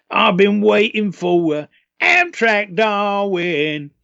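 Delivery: high, slow put-on Cockney; hammed-up Cockney bit in the studio; Cockney accent. Singer retelling his Cockney hidden track, in a put-on Cockney voice. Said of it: "I'm gonna do it with a Cockney accent".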